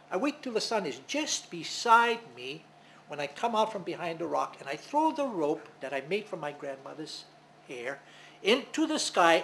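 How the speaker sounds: ordinary delivery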